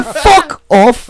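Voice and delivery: put-on voice telling off. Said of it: "That's why I say".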